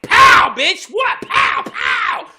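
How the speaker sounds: very high, very loud pow; hammed-up fight shout; mock battle cry. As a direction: yell it like throwing a punch.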